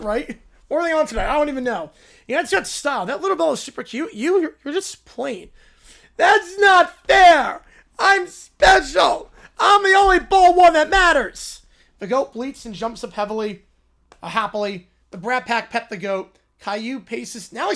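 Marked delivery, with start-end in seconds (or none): 6.2-11.6 put-on high, loud, slow whining child voice; whiny bratty kid. Caillou insisting he is special, slow loud whine. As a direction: stretch out the whine like a spoiled kid.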